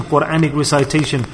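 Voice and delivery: normal talking voice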